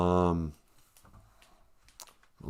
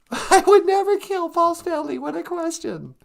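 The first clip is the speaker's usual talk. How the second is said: very high, protesting puppet-like voice; high-voiced character; 4 dB louder than usual. Character rejecting the host's kill question, high voice. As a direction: squeak out a shocked denial.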